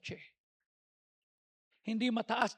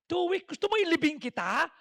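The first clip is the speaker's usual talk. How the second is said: louder, higher disbelief; incredulous scolding; 6 semitones higher, 6 dB louder than usual. Retold or imitated. Pastor scolding sick friend for skipping medicine, loud and incredulous.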